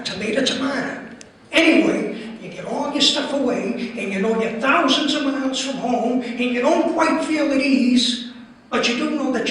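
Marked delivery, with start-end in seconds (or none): none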